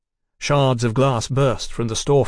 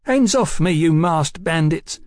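put-on high boyish protest, slowing at the end; defiant cry; 5 semitones higher than usual. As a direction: fend them off in a high, indignant voice. Injured boy fends off the men.

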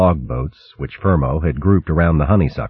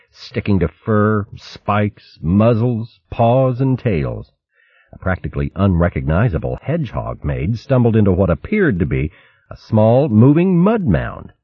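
usual speaking voice

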